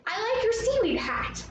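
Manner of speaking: high-pitched friendly compliment. Shown as a demonstration. Teacher models a compliment for Atlantis, friendly. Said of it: "We wanna be friendly".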